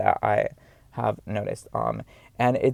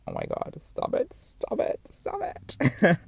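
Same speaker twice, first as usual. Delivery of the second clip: bashful mock protest; 3 semitones higher, slower than usual. Retelling bashful reply to a flirty compliment.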